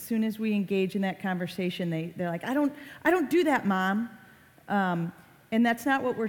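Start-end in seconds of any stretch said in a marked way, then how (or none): 2.4-4.1 quick, higher-pitched protest; defensive kid. Retold or imitated. Kids answering mom about online habits, defensive. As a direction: imitate a child fending off a parent. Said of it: "students get really defensive".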